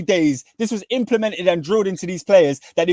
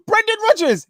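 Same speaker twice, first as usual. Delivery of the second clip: excited announcement; 13 semitones higher than usual. Real, not acted. Announcing his pick.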